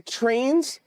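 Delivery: ordinary delivery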